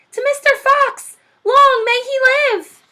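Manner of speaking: high, loud, slow ringing cheer; cheering crowd. Acted. All the animals toasting Mister Fox, cheering loudly.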